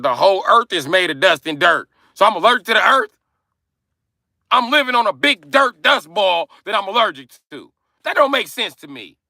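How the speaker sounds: high, loud mock outrage; worked-up, high-pitched imitated tantrum; retold angry rant. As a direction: yell it like an exasperated kid arguing back. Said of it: "I got mad".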